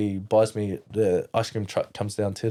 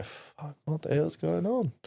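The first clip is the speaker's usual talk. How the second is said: hushed, higher-pitched bewilderment; retold alarmed reaction; 7 semitones higher, 5 dB quieter than usual. Boy called into the kitchen, retold alarm. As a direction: softly voice a worried "what now".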